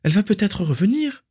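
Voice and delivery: high, desperate plea; begging. A narrator's reading. Narcissa pleads for her absent sister, begging. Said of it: "supplia Narcissa".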